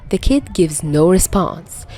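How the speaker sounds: plain reading voice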